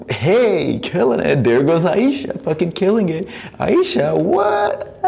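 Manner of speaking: hammed-up fan cheer on the dance; mock cheering. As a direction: hype her up, tongue in cheek.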